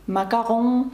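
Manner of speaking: "Macaron" stressed on its nasal last syllable; final-syllable stress. Shown as a demonstration. Teacher giving another French example, stress on -ron. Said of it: "на останній склад падає наголос".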